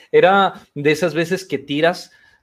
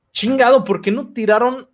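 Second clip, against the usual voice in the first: frustrated outburst; 8 semitones higher than usual. Retold or imitated. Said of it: "pero sí muy frustrado".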